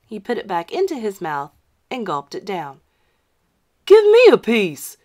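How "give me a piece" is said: loud, high bully voice; schoolboy bully demanding candy. Acted. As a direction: demand it greedily.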